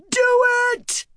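piercing, very high urgent cry; screamed command. A narrator's reading. Woman fighting her horses, screaming at the weaver. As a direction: scream it as an order. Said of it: "Fail screamed".

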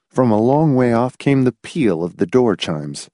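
ordinary delivery, nothing marked

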